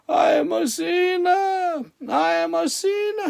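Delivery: high, slow cry of I am a sinner; retold street scream, high and drawn out. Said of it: "And he's screaming".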